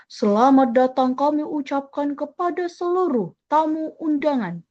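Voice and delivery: forceful, ceremonial welcome, wrong for a wedding; overdone booming welcome to guests; loud formal MC. Shown as a demonstration. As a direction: announce it hard and stiff, like a state ceremony. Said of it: "Kalau mau pakai volume yang keras".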